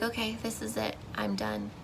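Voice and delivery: hopeless murmur at the window; defeated resignation. Retold or imitated. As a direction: give up, barely voiced. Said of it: "staring out the window like".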